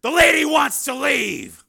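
slow, loud menacing shout; angry threat. Acted. Steele grabbing the pushy man, slow angry shout. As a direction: lay down the law.

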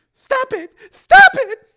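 shrill desperate scream. Acted. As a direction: scream at him to stop. Woman screams at him.

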